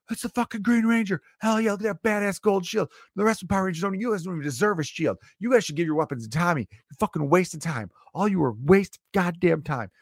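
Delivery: high, pumped-up hype about the Green Ranger; hyped fan voice. Retold or imitated. Fan rants to the other Rangers about Tommy, high and hyped. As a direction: hype him up like a kid who worships Tommy.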